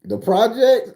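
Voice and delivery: loud, high disbelieving "The projects?"; incredulous question. Retold or imitated. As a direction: act like the phrase baffles you. His incredulous reply to her.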